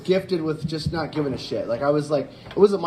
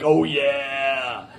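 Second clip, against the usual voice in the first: light Kool-Aid Man tic with a laugh; 4 semitones lower than usual. Retold or imitated. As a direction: blurt the mascot line half-laughing. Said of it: "the one day I was like".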